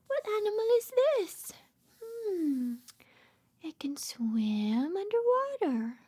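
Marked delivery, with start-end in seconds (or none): none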